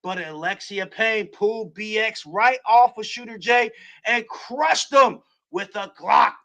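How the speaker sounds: excited wrestling play-by-play; play-by-play call. Acted. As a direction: call the match like a ringside announcer.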